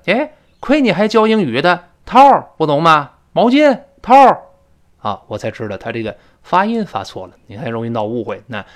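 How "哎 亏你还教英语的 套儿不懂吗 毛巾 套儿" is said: higher, louder mock-indignant retort; imitated exasperation. Friend scolds the English teacher over a misunderstood word, exasperated. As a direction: act out an impatient friend's scolding. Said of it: "那他还急了".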